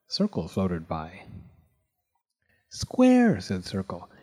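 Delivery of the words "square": high, eager call of his name. A narrator's reading. Circle greets Square eagerly.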